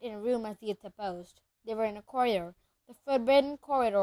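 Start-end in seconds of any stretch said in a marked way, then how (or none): none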